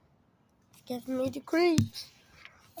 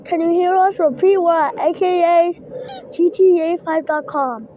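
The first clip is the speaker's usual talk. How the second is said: loud, formal walkie-talkie call, louder; radio operator call; 4 semitones higher, 10 dB louder than usual. Pretend. Kid calling other walkie-talkies on the radio, loud and formal. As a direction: announce it like a radio operator over the air.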